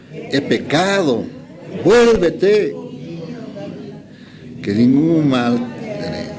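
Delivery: slow, measured Bible reading; scripture reading. Acted. Preacher reading the verses aloud to the congregation, slow and measured. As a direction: read each verse out slowly and deliberately.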